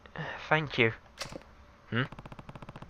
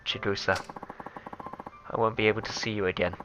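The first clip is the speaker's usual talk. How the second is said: softer, sad voicemail from a dying man; quiet, sorrowful recorded message; soft farewell message; 2 semitones lower, 8 dB quieter than usual. Acted. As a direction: speak it like a last goodbye. Reads dying lover's phone message.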